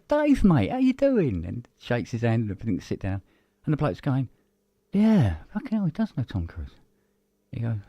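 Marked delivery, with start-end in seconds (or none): 0.1-1.4 much higher, louder matey greeting; cheery celebrity greeting. Retold or imitated. Tom Cruise greeting Dave. 4.9-6.7 higher startled exclamation; amazed mate. Retold or imitated.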